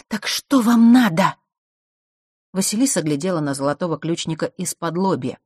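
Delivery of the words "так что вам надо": loud, high, slow question; raised-voice, drawn-out challenge; exasperated demand. A narrator's reading. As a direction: demand it, stretching every word. Confronting unwanted visitors, demanding answer.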